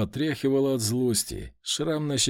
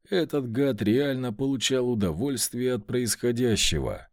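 ordinary delivery, nothing marked

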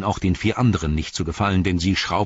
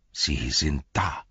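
a touch of laboured effort, slow to start; words forced out; 5 semitones lower, 4 dB quieter, slower than usual. A narrator's reading. Shaken guard pointing Pippin to the horrors below, words forced out. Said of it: "brachte Beregond mühsam wieder Worte hervor".

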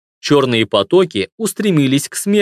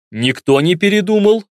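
loud question; 4 semitones higher than usual. A narrator's reading. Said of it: "громко спросил я".

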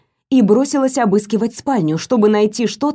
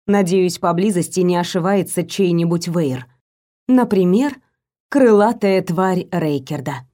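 plain reading voice

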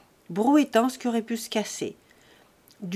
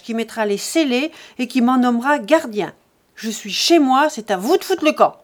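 very loud, higher, raging defiance; furious shout; 4 semitones higher, 8 dB louder than usual. A narrator's reading. Jean finally losing his temper at the family, shouting. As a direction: roar back as loud as they did. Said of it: "se fâcha, cria aussi fort que les autres".

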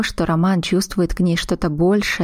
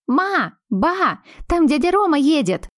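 put-on high, bright child's shout from afar; a little girl yelling the news; excited kid calling grandma and mum; 9 semitones higher than usual.

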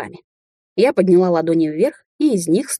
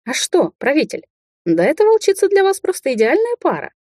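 high, singsong mock praise; 11 semitones higher than usual. A narrator's reading. Witch recommends she-wolf to rulers, mocking. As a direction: tease with exaggerated high-pitched approval.

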